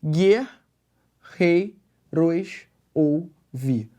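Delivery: "Guerreiros ouvi" split by long pauses; slow, separated syllables in "Guerreiros ouvi"; syllable-by-syllable scansion. Shown as a demonstration. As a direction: give each syllable of "Guerreiros ouvi" its own beat. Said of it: "Vou parar no vi".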